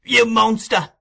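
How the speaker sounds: high, loud accusation; horrified boy. A narrator's reading. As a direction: cry out in horror at a murderer. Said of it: "looking with horror in his eyes".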